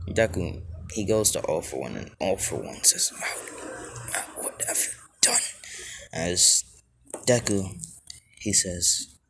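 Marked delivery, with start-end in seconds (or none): 3.1-5.4 breath-only, faltering villain; hushed, acted dismay; stunned whisper. Defeated villain asks in shock.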